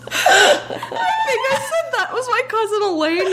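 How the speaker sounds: high, laughing recollection. Real, not acted. Recalling what she texted, high and laughing. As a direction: remember it through giggles.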